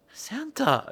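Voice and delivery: small, high, questioning voice; sleepy child. Retold or imitated. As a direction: imitate a child waking to find Santa. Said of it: "And then Ellie".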